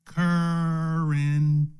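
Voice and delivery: currency stretched very slowly; drawn-out word. Shown as a demonstration. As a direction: draw the word currency out, slow and weighty. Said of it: "watch this now".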